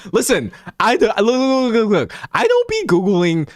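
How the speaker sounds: high, pleading look look look; excited protest. Real, not acted. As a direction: jump up in pitch to defend yourself. Defensive outburst to chat.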